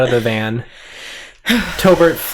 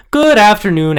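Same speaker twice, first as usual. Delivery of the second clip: higher, louder cheery greeting; bright hostess greeting; 8 semitones higher, 6 dB louder than usual. Acted. Hostess greeting the applicants, cheerful and loud. GM voicing tour guide greeting.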